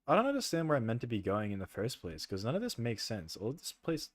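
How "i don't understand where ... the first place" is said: ordinary talk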